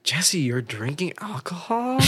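put-on high, drawn-out kid's question; nosy little kids. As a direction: imitate a child's slow, high questioning. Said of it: "Those kids were like".

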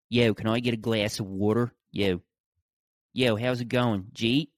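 put-on demonstrating a thick Philly accent. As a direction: do casual Philly street talk with local vowels. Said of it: "That's how they talk".

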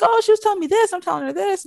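high-pitched daughter voice; high mock-casual reply; imitated breezy daughter. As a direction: answer in a high put-on voice. Voices the daughter answering dad.

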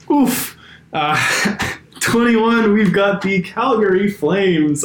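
holding back laughter, voice squeaking high; trying hard not to crack up; stifled giggles. Real, not acted. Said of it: "really trying not to laugh too loud there".

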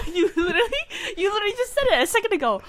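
high, loud, through real laughter; laughing disbelief. Real, not acted. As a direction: call them out while cracking up. Laughing at the sibling's denial.

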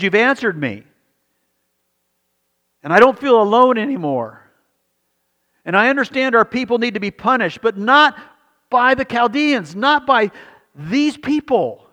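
loud, high, anguished plea to God, slowing at the end; Habakkuk pleading. Retold or imitated. Prophet Habakkuk crying out to God, loud anguished plea. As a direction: cry out to God, drawing out the last words. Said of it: "He says".

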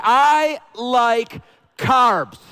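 loud, very slow declaration, each word stretched; drawn-out shout. Acted. Preacher declaring love of carbs, drawn-out playful shout. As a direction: belt it out slowly as a punchline. Punchline of the lyric parody.

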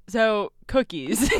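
loud, long-drawn southern twang. Shown as a demonstration. Podcaster challenged by her co-host, hammed-up southern twang. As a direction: stretch it out in a hammed-up southern drawl. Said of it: "where's your southern twang".

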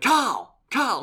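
high, loud shout across a room; imitating an excited yell; screamed name call. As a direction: call out as if spotting someone far off. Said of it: "someone just screamed from across the room".

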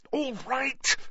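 cried out. A narrator's reading. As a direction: cry out to make him stop. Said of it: "cried Harry".

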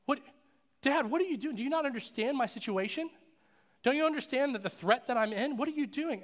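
high, loud, incredulous pleading; acted panicked protest. Allergic son questioning Dad as the bee flies, panicked protest. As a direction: protest in mock panic, pitched well up.

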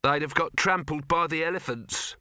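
ordinary delivery, nothing marked

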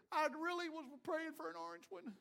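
drowning man. Acted. Drowning man still picky.

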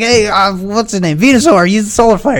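put-on high, goofy kid voice; dopey friend voice. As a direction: play a dim kid calling out attacks. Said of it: "He's like".

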